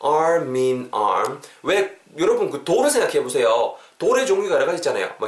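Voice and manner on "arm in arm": "arm in arm" very slowly as "ar-min-arm"; slow teaching model of "arm in arm", m carried over; linked, broken down. Shown as a demonstration. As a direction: stretch each linked syllable of "arm in arm". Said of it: "arm이랑 in이 붙으면서".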